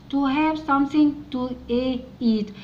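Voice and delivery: to have something to, very slowly; very slow reading. Shown as a demonstration. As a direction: read it out slowly for learners. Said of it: "teacher reads slowly". (قراءة بطيئة جدًا)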